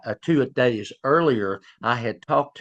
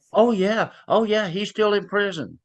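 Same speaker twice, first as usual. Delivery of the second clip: excited retold quote; 9 semitones higher than usual. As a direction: relay her delighted answer, pitched up. Victim retelling gleeful greeting.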